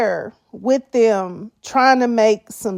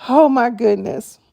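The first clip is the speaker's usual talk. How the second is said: usual speaking voice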